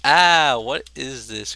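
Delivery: usual speaking voice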